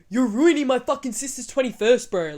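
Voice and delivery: a hint of a fired-up brother; brother's mock yell. Brother yelling at the guard.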